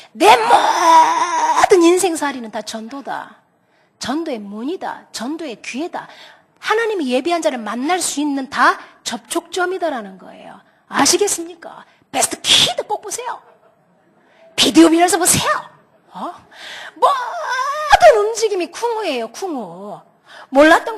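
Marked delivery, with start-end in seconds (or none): 0.1-3.4 choked-up declaration. Real, not acted. Preacher's moved realization. 16.9-20.0 tearful cry. Real, not acted. Preacher cries out, moved.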